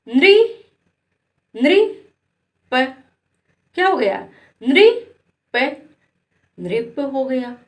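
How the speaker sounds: नृ and प split, then joined as नृप; sound out then blend. Shown as a demonstration. Teacher sounds out नृप piece by piece for children. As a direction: read it in pieces then together. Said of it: "इसको क्या बोलेंगे?".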